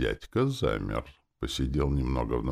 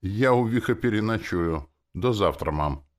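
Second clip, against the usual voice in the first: businesslike; 7 semitones higher, 4 dB louder than usual. A narrator's reading. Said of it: "деловито договорила Керри".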